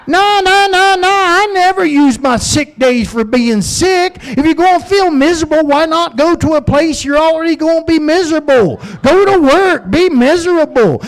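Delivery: very high, loud hammed-up eager worker voice; gung-ho coworker. Old-school coworker bragging about working sick, high loud hammed-up cheer. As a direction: play the overzealous office martyr, cheery and shrill-pitched high.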